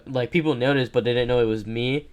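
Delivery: ordinary talk, nothing marked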